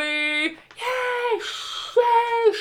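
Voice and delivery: crowd cheering. Pretend. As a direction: cheer like a whole stadium. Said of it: "This is the crowds of people cheering".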